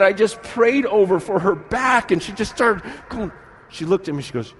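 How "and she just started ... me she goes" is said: normal talking voice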